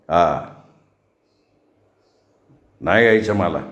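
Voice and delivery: low, curt refusal; angry reply. A narrator's reading. Brother angrily refuses to go see the bride, low curt voice. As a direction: bite off a flat refusal. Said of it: "भुजंगाने संतापाने उत्तर दिलं".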